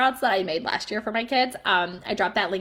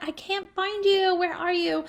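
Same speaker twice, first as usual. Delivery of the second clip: mock-puzzled parent, pitched up; pretend searching; 9 semitones higher than usual. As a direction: play dumb looking for a kid. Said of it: "you're like".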